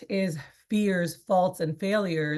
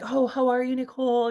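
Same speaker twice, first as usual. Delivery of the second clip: chirpy small talk; 7 semitones higher, 3 dB louder than usual. Retold or imitated. Voicing cliché small talk.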